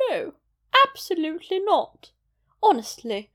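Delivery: a prim British matron refusing outright; older British lady. Shown as a demonstration. Vlogger trying her older British lady voice, indignant British accent. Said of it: "attempt to do sort of an older British lady".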